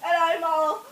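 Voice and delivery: ordinary delivery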